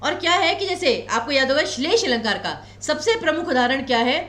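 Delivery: ordinary talk, nothing marked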